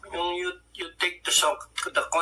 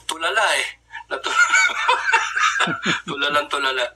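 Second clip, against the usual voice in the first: a laughing shout about being dazed; laughing hard at how dazed he was; 2 semitones higher, faster than usual. Real, not acted. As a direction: burst out laughing.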